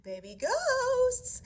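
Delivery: put-on high, loud drawn-out call to the kids. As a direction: sing out loudly to round up the little ones. Mama ghost calls her babies.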